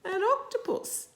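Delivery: excited reveal. A narrator's reading. Reading to a child, revealing the octopus excitedly. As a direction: announce the surprise to the little ones.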